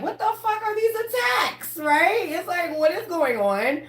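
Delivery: high, incredulous pitch; outraged disbelief. Real, not acted. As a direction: voice jumps up in outrage. Outraged at attacks.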